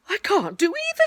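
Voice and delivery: high, loud, helpless protest; dismayed. Acted. Pooh unable to go either way, dismayed.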